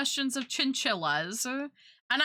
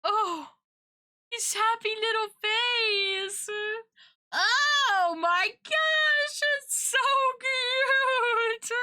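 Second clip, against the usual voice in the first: squealing over cute; 13 semitones higher, 3 dB louder, much slower than usual. Real, not acted. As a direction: coo it out, melting at the cuteness. Gushing over cute picture.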